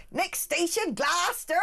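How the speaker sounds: put-on Massachusetts accent. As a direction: call the stop in a broad Massachusetts accent. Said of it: "the announcements for the, the train in Massachusetts".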